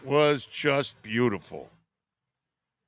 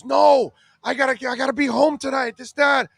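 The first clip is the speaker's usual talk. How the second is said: higher and louder, a mock panicked protest; 9 semitones higher, 5 dB louder than usual. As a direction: play the friend begging to get home. Abducted kid protesting in panic.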